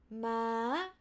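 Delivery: "ma" from mid up to high. Shown as a demonstration. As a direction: lift "ma" at the end. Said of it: "mid to high".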